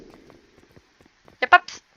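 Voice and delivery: slow breathy psst, drawn out; slow whispered hiss. Shown as a demonstration. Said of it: "si vous vous posez la question".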